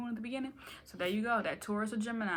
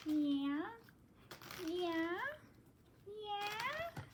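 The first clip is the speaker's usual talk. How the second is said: playful rising yeah; 11 semitones higher, 7 dB quieter, much slower than usual. Pretend. Climbing playful yeahs.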